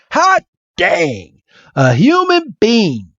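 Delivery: hammed-up Southern amazement; Bill. Bill spots a human at last, Southern accent. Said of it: "this Southern accent".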